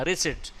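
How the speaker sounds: रिसिप्ट said ri-sit, p left out; silent p. Shown as a demonstration. Teacher showing students, रिसिप्ट with silent p. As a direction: give रिसिप्ट with no p sound. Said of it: "रिसिप्ट में पी नहीं बोलना".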